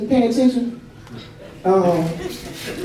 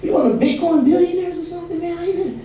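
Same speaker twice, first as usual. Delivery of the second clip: mock nosy neighbor; 7 semitones higher than usual. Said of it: "It's like".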